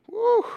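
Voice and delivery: high, loud "woo"; mock woo. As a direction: let out a high mocking whoop. Mocking onlookers about Judy.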